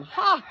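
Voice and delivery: a loud, high scoffing "Ha!"; scornful parrot laugh. A narrator's reading. Parrot scoffing at people calling animals dumb, loud high "Ha!". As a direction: bark out a high mocking laugh.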